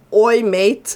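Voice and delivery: loud Aussie Oi, mate call; Australian accent. Shown as a demonstration. Streamer giving chat the Australian ara ara, loud Aussie greeting. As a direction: bark Oi, mate like a cheery Aussie bloke. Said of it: "Australian version of ara, ara".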